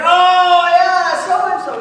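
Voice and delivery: loud, high, drawn-out realization; dawning recognition. Retold or imitated. Pastor finally placing the acquaintance, acted dawning recognition. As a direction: stretch it out as it suddenly clicks. Name finally comes back to him.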